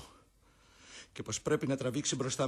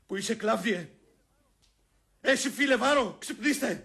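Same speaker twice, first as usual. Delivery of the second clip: higher, louder calls to the sleepers; calling out; 8 semitones higher, 8 dB louder than usual. Acted. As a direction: shout them awake. (ο Βρούτος φωνάζει στους κοιμισμένους)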